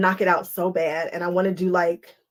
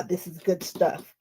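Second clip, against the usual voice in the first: ordinary delivery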